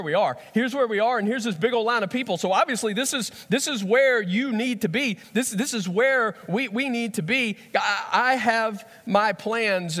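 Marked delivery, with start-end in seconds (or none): 0.5-7.6 higher, pushy know-it-all tone; mock insistence. Mocking our own insistence. 7.8-10.0 slow, higher, deliberate claim; self-assured believer. Retold or imitated. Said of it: "where we go".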